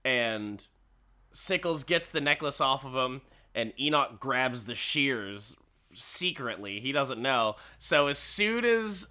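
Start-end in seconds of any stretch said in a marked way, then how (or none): none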